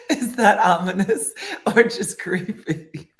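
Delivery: breaking into real laughter; laughing. Real, not acted. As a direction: crack up while asking. Cracking up at own line.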